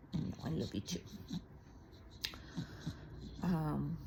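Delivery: normal talking voice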